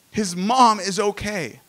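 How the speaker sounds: usual speaking voice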